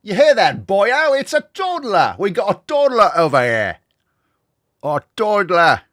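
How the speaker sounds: loud, high mock Welsh, calling out; Welsh accent. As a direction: bellow it like a Welsh pub regular. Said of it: "I love accents".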